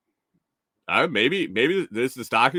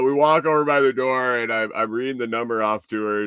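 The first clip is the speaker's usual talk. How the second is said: ordinary delivery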